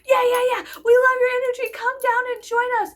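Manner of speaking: high, loud mock cheering; imitating cheering protesters. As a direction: cheer her on from the street. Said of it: "they're like".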